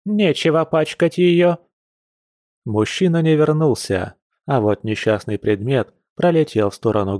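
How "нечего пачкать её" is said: high-pitched stern granny; strict scolding. A narrator's reading. Grandma scolds him over the pillow, stern and high. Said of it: "ещё строже велела баба Эля".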